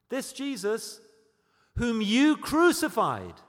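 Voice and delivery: loud, slow, high accusing proclamation; preaching at full force. Acted. Preacher charging the crowd with the crucifixion, at full force. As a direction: thunder it at the crowd.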